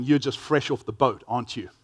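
usual speaking voice

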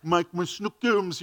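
put-on cooing pet name to a baby; baby talk. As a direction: coo it like a doting dad. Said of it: "They say silly things without shame".

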